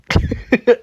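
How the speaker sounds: scornful burst of laughter; mocking laugh. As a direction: laugh in her face.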